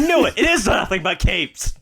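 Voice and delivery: high, loud vindicated cry; triumphant. Retold or imitated. Son finding his father's capes, loud and triumphant. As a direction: crow it out, vindicated. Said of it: "It's like".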